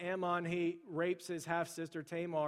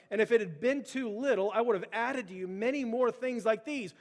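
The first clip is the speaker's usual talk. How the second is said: prophet's rebuke; 3 semitones higher, 5 dB louder than usual. Acted. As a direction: thunder the Lord's rebuke at the king. Preaching God's word to David.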